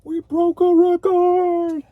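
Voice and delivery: loud, high, slow stretched-out victory shout; triumphant cheer. Real, not acted. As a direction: drag it out like a stadium cheer. Celebrates breaking a record.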